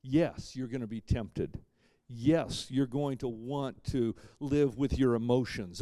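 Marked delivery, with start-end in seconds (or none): none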